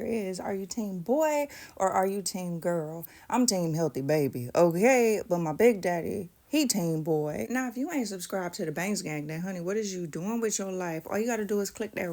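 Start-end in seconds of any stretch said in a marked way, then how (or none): none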